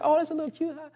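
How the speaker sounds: very high little coo; cute cooing. Retold or imitated. Parent reacting to a baby's cute cry, high-pitched cooing. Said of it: "the crying is like".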